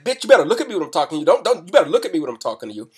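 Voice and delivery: fast, loud, high threatening yell; menacing shout to shock her into looking away; furious threat. Retold or imitated. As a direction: snap at her fast to scare her stiff. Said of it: "I raised my hand back. I said".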